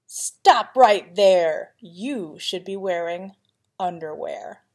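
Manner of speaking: ordinary delivery, nothing marked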